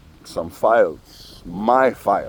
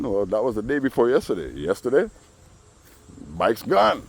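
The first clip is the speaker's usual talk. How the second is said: ordinary delivery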